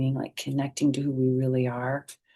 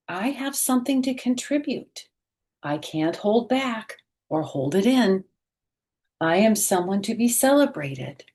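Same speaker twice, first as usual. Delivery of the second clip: bright, expressive picture-book read-aloud; reading to children; 4 semitones higher than usual. A narrator's reading. Teacher reading a picture book aloud to children, expressive. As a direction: read it slowly and warmly to young listeners.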